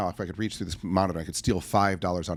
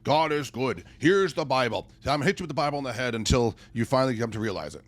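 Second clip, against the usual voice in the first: mock Bible thumper; 4 semitones higher than usual. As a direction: parody a preacher hammering the Bible. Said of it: "me standing up here and spouting out".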